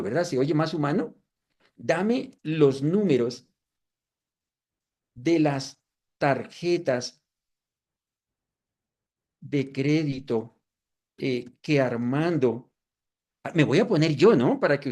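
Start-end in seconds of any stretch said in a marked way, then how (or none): 1.8-12.7 slow, careful, spelling out each phrase; unhurried spoken prompt; slow dictation. Shown as a demonstration. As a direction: dictate it slowly as you type.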